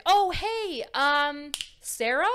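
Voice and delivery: loud, high, uncertain hey; acted awkward surprise, rising on the name; caught off guard. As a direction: greet brightly, then grope for the name.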